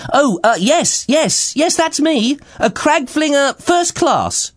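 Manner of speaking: very high, eager and triumphant. Acted. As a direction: bluff it out with glee. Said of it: "said Splidge, triumphantly".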